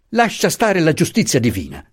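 indignant retort without shouting; violent retort. A narrator's reading. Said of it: "rispose Franco violento".

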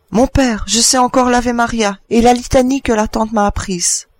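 high, eager pleading voice; anxious child. Acted. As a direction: play for time as a frightened child. Child stalls father before being killed. (enfant apeuré qui supplie)

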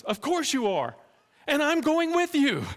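high, loud, insistent reply; determined Sam. Acted. Sam answers Frodo's go back, high, loud and determined. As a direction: refuse to be left behind.

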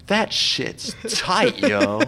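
high, slow slangy delivery; street slang voice. Retold or imitated. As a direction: stretch it out like a street kid. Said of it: "I like to go".

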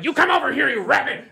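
British actor snapping into character; tight-lipped Bob Hoskins. Retold or imitated. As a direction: switch instantly into a clenched British tough guy. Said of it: "his voice completely changes".

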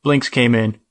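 usual speaking voice

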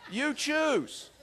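high-pitched, comic ultimatum; mock ultimatum. Doctor's mock ultimatum in retold quarrel.

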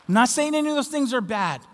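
ordinary delivery

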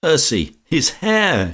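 high-pitched cry of hilarity; amused exclamation. A narrator's reading. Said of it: "she went off into another yell of laughter".

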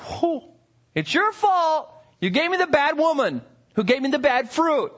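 hammed-up defensive Adam; mock Adam excuse. Preacher mimicking Adam blaming God, hammed-up. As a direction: play Adam pointing the finger. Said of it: "So Adam says".

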